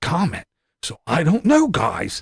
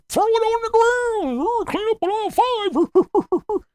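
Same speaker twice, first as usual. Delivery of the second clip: squeaky mock voice. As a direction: squeal it like a cartoon. Mimicking Tater, very high.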